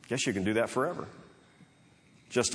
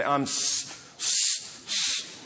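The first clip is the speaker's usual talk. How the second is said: stuck on the first sound of sorry, breathy and cut off; imitating the Fonz choking on sorry; Fonz unable to say sorry; 3 semitones higher, much slower than usual. Preacher imitating the Fonz failing to apologize, stuck stammer. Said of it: "he just couldn't get the word out".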